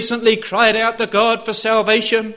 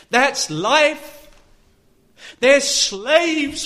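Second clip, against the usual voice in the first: impassioned sermon delivery, pressing every phrase; forceful preaching; 4 semitones higher than usual. Real, not acted. Preacher warning the congregation about slavery to sin, forceful preaching. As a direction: preach it hard, stretching the final warning.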